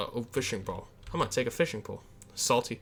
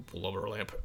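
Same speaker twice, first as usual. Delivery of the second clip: a softer, lower blubber lamp; softer repeat; 5 semitones lower, 9 dB quieter than usual. Shown as a demonstration. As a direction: say blubber lamp again, dropping the voice. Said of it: "Say it again".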